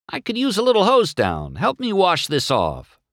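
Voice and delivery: high-pitched called request; calling out. A narrator's reading. McQueen asking the fire truck for a wash, calling.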